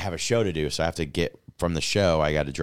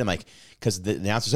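usual speaking voice

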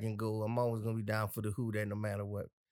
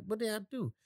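higher, puzzled what did I do; retold confused question; 9 semitones higher, faster than usual. Host retells a listener's angry text, acting baffled. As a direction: play the innocent, baffled. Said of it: "I'm like".

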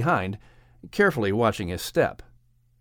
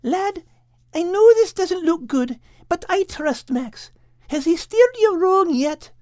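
much higher voice, coaxing Al; a high voice urging her friend to trust; Kate the cat; 4 dB louder than usual. Acted.

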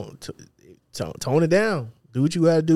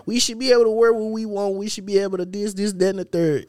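higher, whiny voice imitating women; mimicking women's complaint; 7 semitones higher than usual. Imitating women online, higher and whiny. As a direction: pitch it up like a fed-up woman complaining. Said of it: "what a lot of women be saying".

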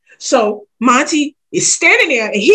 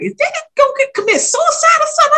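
alarmed worry. Retold or imitated. Worried call to her sister.

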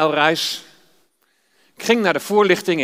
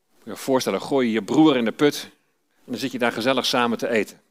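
ordinary delivery

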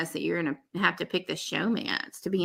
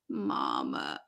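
mock tearful Mama; 8 dB quieter than usual. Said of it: "and he said".

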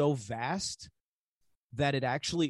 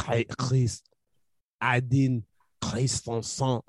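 fake French accent; 4 semitones lower than usual. Joking to a friend that it's French, mock French accent.

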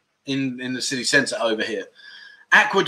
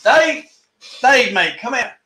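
calling out; 8 semitones higher, slower than usual. Pretend. Pretending to look for Dave, he calls out his name. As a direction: shout for Dave as if he's nearby.

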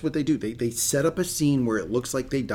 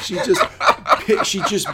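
laughing; 7 semitones higher, 7 dB louder, slower than usual. Real, not acted. Laughing at the book scene.

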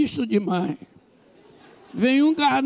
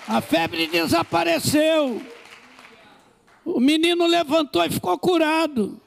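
triumphant preaching; 6 semitones higher than usual. Real, not acted. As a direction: sing out the miracle in triumph. Triumphant miracle climax.

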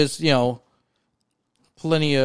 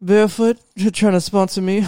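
higher, louder snooty rich man; 4 semitones higher, 3 dB louder than usual. Pretend. Imagining winning the Powerball, higher smug rich voice. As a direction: play a smug lottery winner brushing off a sponsor. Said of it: "I'm gonna do the podcast just in that voice".

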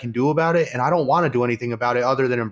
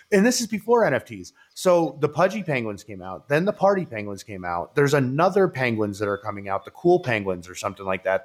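usual speaking voice

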